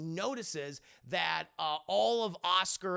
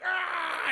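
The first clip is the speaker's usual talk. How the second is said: high, loud drawn-out "ah"; imitated battle yell; 12 semitones higher, 5 dB louder than usual. Retelling Zeke striking back, imitated yell. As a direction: mimic a high attack cry.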